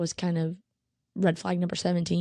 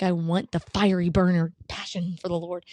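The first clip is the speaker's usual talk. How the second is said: normal talking voice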